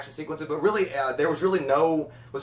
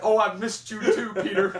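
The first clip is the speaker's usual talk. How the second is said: higher, drawn-out sweet reunion voice; reunited movie pet; 6 semitones higher, slower than usual. Retold or imitated. Mimicking the Homeward Bound reunion, higher-pitched mock sweetness. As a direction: playfully imitate a pet greeting its owner.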